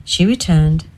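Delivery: ordinary delivery, nothing marked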